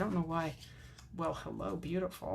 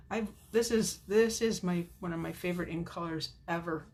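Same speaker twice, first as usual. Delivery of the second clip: ordinary delivery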